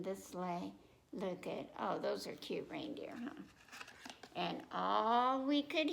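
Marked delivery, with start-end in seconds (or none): none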